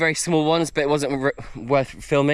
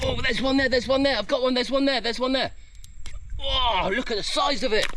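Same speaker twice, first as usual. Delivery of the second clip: high, urgent run of excited repeats; excited sighting; 8 semitones higher than usual. Real, not acted. Man spotting a crab in its hole, excited. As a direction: blurt it out at the thrill of spotting it.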